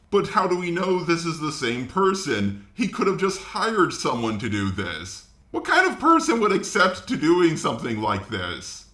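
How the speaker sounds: ordinary talk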